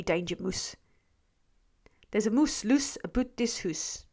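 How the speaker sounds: put-on soft Scottish accent, moose rhymed with hoose; lightly put-on Scots for the rhyme. Streamer playing with a Scottish accent for a commenter. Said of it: "sounds like a Scottish person".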